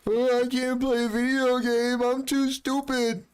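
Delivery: high whiny mock of her; mocking his ex in a high whiny voice; mocking crybaby voice. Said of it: "She's a crybaby".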